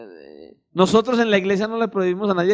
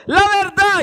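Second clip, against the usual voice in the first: high, louder insistent urge; Holy Spirit prompting; 10 semitones higher, 3 dB louder than usual. Acted. Holy Spirit urging the believer, high and insistent. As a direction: press the word like an inner voice.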